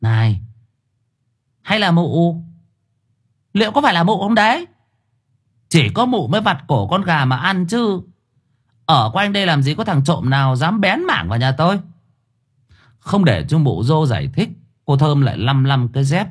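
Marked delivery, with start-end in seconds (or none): 0.0-11.8 rising, accusing questions; suspicious. A narrator's reading. Thơm accuses the ghost of eating her chickens, suspicious. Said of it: "tự nhiên ngờ vực".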